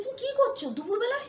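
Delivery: high, loud mimicked questioning; retold incredulous question. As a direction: imitate a visitor catching you out. Retold visitor questioning her.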